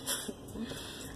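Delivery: breathy laugh. Real, not acted. Laughs at herself.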